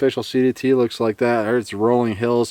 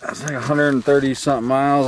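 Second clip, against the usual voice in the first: usual speaking voice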